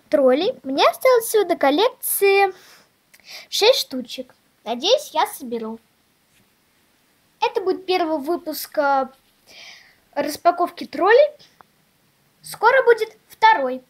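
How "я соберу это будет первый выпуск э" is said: ordinary talk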